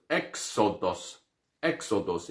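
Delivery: exodos softly, stress on the first syllable; quiet repeat of exodos; soft Greek exodos; pronunciation demo. Shown as a demonstration. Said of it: "O êxodo mesmo".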